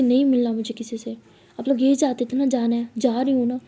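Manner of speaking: loud, hurt outburst at family; acted angry refusal. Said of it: "ऐसे गुस्से से सबसे बात कर रही".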